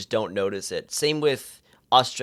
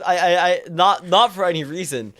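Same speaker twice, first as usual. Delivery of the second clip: ordinary delivery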